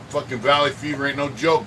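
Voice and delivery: normal talking voice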